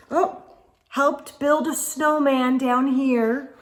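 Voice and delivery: usual speaking voice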